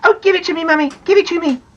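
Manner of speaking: put-on high excited child's plea; crying out. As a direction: beg loudly like an eager child. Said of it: "cried Janet".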